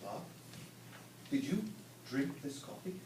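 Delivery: conspiratorial hush to coworker; hushed question. Acted. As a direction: ask it like a secret. Secretive question, Bob says what.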